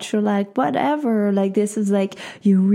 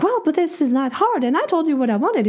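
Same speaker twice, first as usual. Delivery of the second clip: mock argument; 8 semitones higher, faster than usual. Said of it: "going back and forth".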